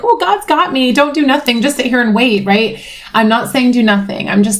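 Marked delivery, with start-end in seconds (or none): 0.0-2.4 mock believer, pitched high and easing down; passive religious believer. Mimicking someone who leaves it all to God, mock-pious. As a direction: put on a higher voice for blind faith. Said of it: "I'm not a religious person. I'm not like".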